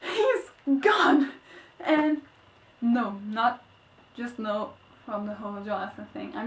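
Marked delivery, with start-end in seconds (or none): 0.0-1.3 much higher, delighted cheer; gleeful relief. Retold or imitated. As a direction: celebrate the villain's death. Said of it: "I was like".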